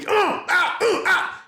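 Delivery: very high wordless parent outburst; mock angry yelling. Dad showing how parents overdo it on kids, mock ranting noises. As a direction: imitate losing it with wordless cries. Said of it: "we yell at them and we get mad".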